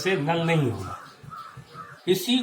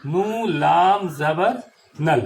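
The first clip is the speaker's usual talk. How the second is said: letter by letter, slowly, like a teacher; slow spelled-out letters; slower than usual. Shown as a demonstration. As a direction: spell it out slowly, then join it into نل. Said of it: "جیسے نل".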